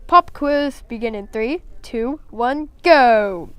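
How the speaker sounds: loud teacher announcement; announced. A narrator's reading. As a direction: call the countdown out to the class. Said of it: "Mister Greenville announced".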